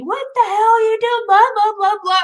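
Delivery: loud, high mock yell; high-pitched rant fading into blah-blahs; retold screaming. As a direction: imitate an irate trucker hollering. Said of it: "started screaming at".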